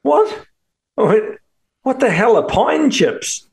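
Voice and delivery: high-pitched, incredulous retold question; baffled disbelief. Guest retelling his confusion at his host's offer, baffled.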